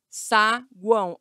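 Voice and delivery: "saguão" split slowly into sa, guão; syllable by syllable. Shown as a demonstration. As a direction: pause between the two syllables of "saguão". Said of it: "Olha as três vogais".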